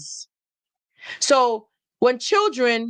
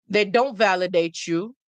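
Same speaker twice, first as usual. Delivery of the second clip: normal talking voice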